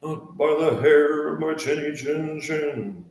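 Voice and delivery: high, slow, playful chant; sing-song storybook voice. Pretend. To the camera, a high slow storybook chant. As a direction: ham up the fairy-tale line.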